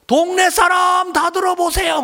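high, loud call from the rooftop; calling out to the village. Retold or imitated. Mother on the rooftop calling to all the neighbours, loud and high. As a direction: cry out so the whole village hears. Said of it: "온 동네에 소리가 들리는 거예요".

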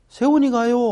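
hesitant teacher. Retold or imitated. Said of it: "하면서 말 안 하는 거예요".

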